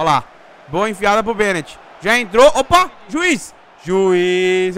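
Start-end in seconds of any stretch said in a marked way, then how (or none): 2.0-4.8 loud outraged call to the ref; shouting at referee. Real, not acted. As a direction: yell juiz in protest. Protesting foul to referee.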